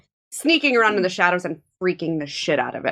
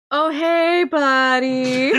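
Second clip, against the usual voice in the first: higher, drawn-out hello; friendly in-character greeting; 3 semitones higher, much slower than usual. Acted. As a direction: coax it like a little pal. Player greets the fire creature.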